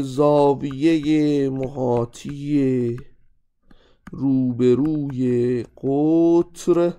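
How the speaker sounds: very slow, word by word for note-taking; slow dictation. Shown as a demonstration. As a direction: spell it out slowly so students can write. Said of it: "dictating a rule to note down". (خیلی آهسته و کلمه به کلمه برای دیکته)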